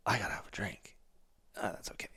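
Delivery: soft, half-whispered thought to himself; inner voice. Retold or imitated. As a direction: mutter it like a private excuse. Inner voice justifying a drink.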